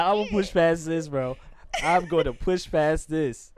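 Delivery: imitating determined reader. Host voicing player stuck on a word, mock determined. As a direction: drive on stubbornly, dragging out the repeat. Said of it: "He's like".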